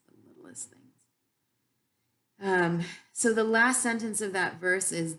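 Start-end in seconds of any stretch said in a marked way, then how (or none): 0.1-0.8 barely audible whispered echo; fading whisper. Real, not acted. Echoing the littlest things to her audience, nearly silent. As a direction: let the repeat die away to almost nothing.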